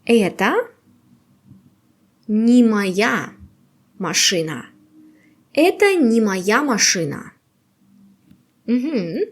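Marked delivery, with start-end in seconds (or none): none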